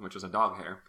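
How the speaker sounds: ordinary talk, nothing marked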